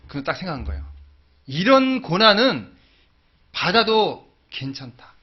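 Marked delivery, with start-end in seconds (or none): 1.5-5.1 very slow, louder and higher, each word weighed; slow resolve. Acted. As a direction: lay it down slowly like a vow. Declaring his resolve.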